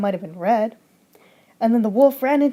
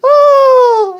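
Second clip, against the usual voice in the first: high shrieking scream of fright; Red shrieks loud and high as the wolf jumps out; 13 semitones higher, 12 dB louder than usual. Acted.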